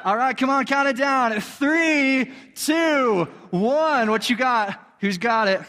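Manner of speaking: high-pitched, hyped crowd countdown; rallying countdown. Real, not acted. Host counts down to the cookie bite, hyped. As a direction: lead the room in counting down.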